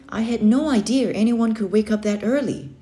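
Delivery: ordinary delivery, nothing marked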